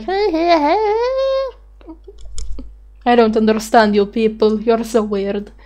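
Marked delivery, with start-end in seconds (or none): 0.0-1.1 higher whiny mock singing. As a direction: whine it up high. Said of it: "my whiny voice going like".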